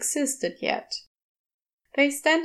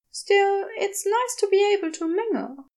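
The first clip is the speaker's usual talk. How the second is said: nervous Aziraphale; 9 semitones higher than usual. A narrator's reading.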